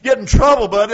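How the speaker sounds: loud, punchy preaching at full voice; fervent preaching. Acted. Preacher warning the church about conviction, loud preaching. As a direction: hammer each phrase at the congregation. Preaching on Holy Spirit drawing.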